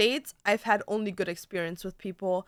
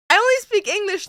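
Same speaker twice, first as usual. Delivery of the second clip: higher, louder helpless protest; mock panicked tourist to the French; flustered protest; 11 semitones higher, 10 dB louder, faster than usual. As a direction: plead that you only speak English. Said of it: "And you're like".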